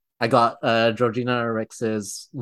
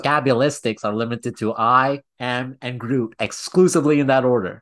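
ordinary talk

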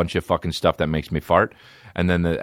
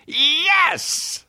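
much higher falsetto squeal of joy; mock excited squeal. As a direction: shriek it like a giddy fan.

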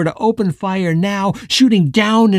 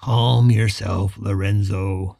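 lower, slow and level; calm and steady; 8 semitones lower, slower than usual. Acted. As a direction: leader calming a hothead, slowly. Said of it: "his voice calm and steady".

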